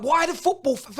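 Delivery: ordinary talk, nothing marked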